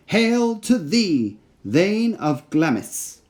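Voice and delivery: high, slow, otherworldly witch voice, each word drawn out; an uncanny crone hailing a general from the mist; eerie witch cry. Acted. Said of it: "cried the first".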